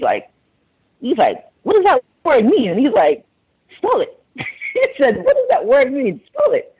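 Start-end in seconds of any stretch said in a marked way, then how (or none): 1.6-2.7 high-pitched mock bafflement, imitating the engineer; incredulous questioning. Caller imitating a coworker puzzled by a word, incredulous. 3.8-4.1 very high mimicked demand; challenging demand. Caller mimicking a coworker demanding a spelling, challenging. 5.2-6.7 laughing, high-pitched mock challenge; amused mimicry. Caller laughing while mimicking a coworker's challenge.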